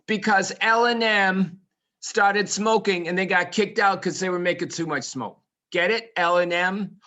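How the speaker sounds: loud, high comic delivery; hammed-up joke telling. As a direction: sell the silly riddle with gusto.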